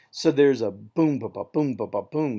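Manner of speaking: boom, then two quick beats, then boom again; syncopated beat. Shown as a demonstration. Teacher beats out the syncopated line for students, rhythmic. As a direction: land hard on each "boom", quick light "ba, ba" between. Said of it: "a syncopation".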